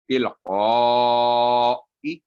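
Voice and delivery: long drawn-out tajweed recitation; slow recitation. Shown as a demonstration. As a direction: chant it slowly with elongated vowels. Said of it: "teacher models Quran recitation".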